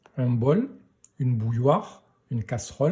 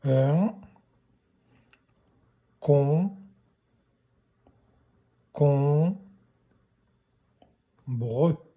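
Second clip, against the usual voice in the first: long pauses between "Un", "con", "con", "bre"; syllable by syllable; much slower than usual. Shown as a demonstration. Teacher writing out the correction for pupils, syllable by syllable. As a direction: break "Un con-, con-, bre" into slow, separate syllables. Spells out the word while correcting.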